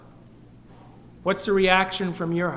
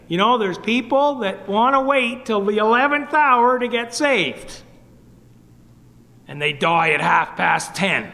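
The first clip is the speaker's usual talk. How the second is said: raised, emphatic preacher's warning; Charles Hale; 5 semitones higher, 5 dB louder than usual. Retold or imitated. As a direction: lightly imitate an old-time gospel preacher's warning. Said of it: "he would say".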